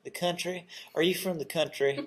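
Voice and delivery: lightly put-on North Carolina twang, rising in mock surprise; North Carolina accent. Host jokes how North Carolina folks would ask, light North Carolina accent. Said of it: "that's my North Carolina accent coming out".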